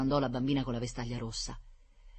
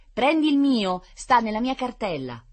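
loud, high-pitched instruction; bossy order; 10 semitones higher, 9 dB louder than usual. A narrator's reading. Lucrezia telling brother about her school bag, bossy. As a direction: order it loudly into the phone.

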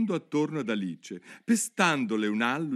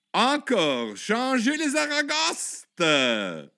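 loud, high, drawn-out howl; yelled; 12 semitones higher, 5 dB louder, much slower than usual. A narrator's reading. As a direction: bellow for an encore, stretching the last word. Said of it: "urlò il grifone". (urlo alto e prolungato)

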